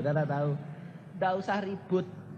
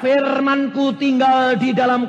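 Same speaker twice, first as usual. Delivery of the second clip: loud, high preacher's proclamation; forceful preaching; 8 semitones higher, 8 dB louder, slower than usual. Acted. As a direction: proclaim the scripture to the congregation. Preaching scripture at full force. (khotbah berapi-api, keras)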